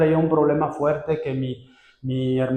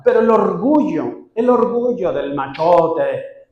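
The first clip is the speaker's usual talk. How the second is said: mock macho pride; 4 semitones higher, 6 dB louder, slower than usual.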